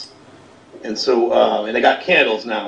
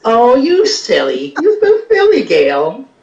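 high giggling devil voice; giggly Satan; 10 semitones higher than usual. Pretend. Satan teasing Gail on the call, high and giggly. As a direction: tease her like a coy little devil.

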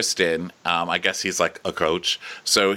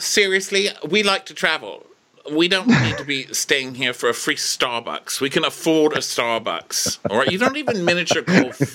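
mock indignant wife; 7 semitones higher than usual. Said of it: "she's like".

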